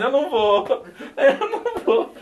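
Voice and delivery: high, loud comic wail breaking into laughter; mock crying. Student packing his bag to return to seminary, mock crying. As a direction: wail it like a hammed-up crybaby. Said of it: "aí você vai arrumar a mala chorando".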